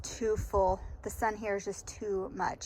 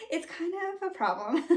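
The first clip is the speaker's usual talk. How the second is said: laughing; 7 semitones higher than usual. Real, not acted. Joking about owning every canning book.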